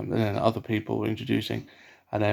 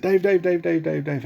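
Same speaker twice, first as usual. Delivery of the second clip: calling out; 7 semitones higher than usual. Retold or imitated. Man calls out name running over.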